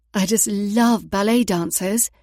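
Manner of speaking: put-on gushing British accent, slow and loud. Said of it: "she gushed, using her best British accent".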